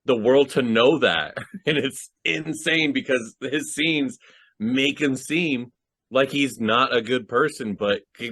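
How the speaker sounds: ordinary talk, nothing marked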